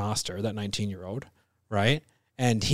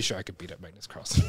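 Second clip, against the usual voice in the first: fast laughing boast; laugh-shout the brag; 9 dB quieter, faster than usual. Real, not acted.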